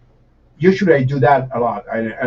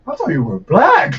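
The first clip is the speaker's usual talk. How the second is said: amused admission; 8 semitones higher, 4 dB louder than usual. Retold or imitated. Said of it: "he looked at me and was like".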